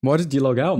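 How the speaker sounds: ordinary talk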